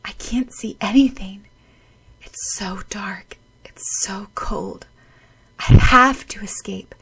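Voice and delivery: breathless whisper. Acted. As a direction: whisper through the cold and dark. Freezing, drowning thoughts.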